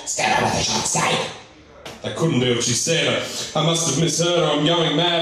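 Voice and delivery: usual speaking voice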